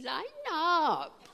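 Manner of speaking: high "Line up", the angel's voice again; the angel herding them into line. Retold or imitated.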